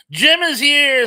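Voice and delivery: high, loud happy announcement; light up as a friend arrives; real delight calling out; excited greeting. Real, not acted.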